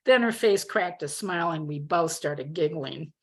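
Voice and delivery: plain reading voice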